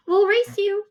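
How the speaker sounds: high, loud playful challenge; excited boy. A narrator's reading. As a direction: call it out like an eager kid. Boy challenges others to a race.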